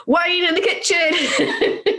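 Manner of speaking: high, slow, laughing cry of delight; excited choice. Retold or imitated. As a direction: squeal it out with delight through a laugh. Choosing wine over watching the show.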